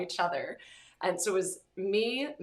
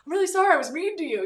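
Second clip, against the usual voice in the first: higher, louder and fast apology; urgent apology; 10 semitones higher, 9 dB louder, much faster than usual. Retold or imitated. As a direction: blurt the apology out, high and loud. Dying soldier apologizing, retold.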